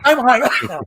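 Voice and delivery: giggling, high-pitched laugh. Real, not acted. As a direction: laugh it out helplessly.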